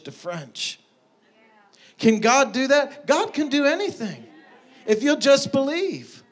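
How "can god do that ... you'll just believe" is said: high, ringing preacher's climax; impassioned preaching. Real, not acted.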